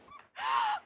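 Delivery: sudden high squeal of a laugh; shrill-free quick laugh; high incredulous laugh. Real, not acted. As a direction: burst out laughing in disbelief. Laughs mid-protest.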